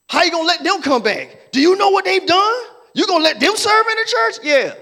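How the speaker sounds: loud, high, offended outrage; indignant church member. Retold or imitated. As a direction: scold as a self-righteous saint.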